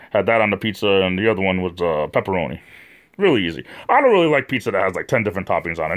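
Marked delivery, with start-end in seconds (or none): none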